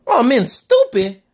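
high, slow indignant repeat; offended surprise. Acted. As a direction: repeat it back, outraged. Dad offended by question.

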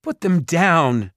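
high, loud drawn-out demand; panicked mother. A narrator's reading. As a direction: cry out in panic for the children. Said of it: "Their mother's voice was panicked".

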